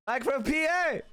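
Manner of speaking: high, goofy, put-on announcer voice.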